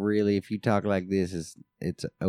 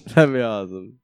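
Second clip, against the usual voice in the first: said through a laugh, louder; 2 semitones higher, 6 dB louder than usual. Real, not acted. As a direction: laugh while you say it. Repeats line, laughing.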